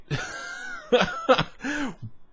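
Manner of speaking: high burst of laughter; real laugh. Real, not acted. Recalling the Laphroaig shock, real laugh. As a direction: laugh at himself.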